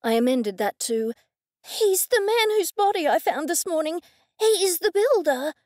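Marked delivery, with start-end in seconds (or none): 1.6-5.5 agitated. A narrator's reading. Goldie excited identifying ghost.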